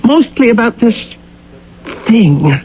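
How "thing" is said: normal talking voice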